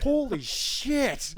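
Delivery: high, loud, drawn-out exclamation; mock astonishment. Stunned viewer of Cage's performance, mock astonishment. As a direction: stretch both words in hammed-up shock.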